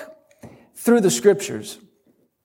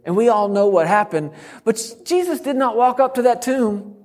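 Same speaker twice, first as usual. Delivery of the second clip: ordinary talk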